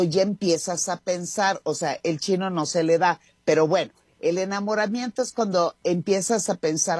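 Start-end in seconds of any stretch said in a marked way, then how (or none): none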